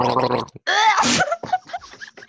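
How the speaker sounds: loud gurgling wet noise; wet sound demo. Shown as a demonstration. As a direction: gurgle noisily as a gross-out demo. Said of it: "let me try that".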